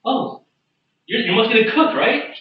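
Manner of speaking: high, lilting questions; high-voiced, halting friendly customer; innocent inquiry. Player feigns innocence to the bartender.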